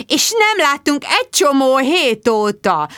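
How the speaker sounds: high, drawn-out slurred reproach; reproachful. A narrator's reading. Jude scolding Tom for his absence, reproachful. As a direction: chide slowly, mumbling the words tipsily. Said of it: "szemrehányóan".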